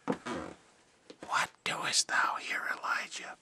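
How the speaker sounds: slow, breathy whisper of God; still small voice. A narrator's reading. Preacher reading God's still small voice to Elijah, whispered slowly. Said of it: "a still small voice".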